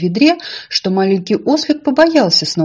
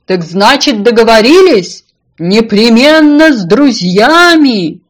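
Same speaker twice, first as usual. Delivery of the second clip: loud, drawn-out singsong call; high, stretched farewell shout; calling out goodbye; 3 semitones higher, 9 dB louder than usual. A narrator's reading. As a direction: holler it as if from across a pond. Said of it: "на прощание крикнула ему".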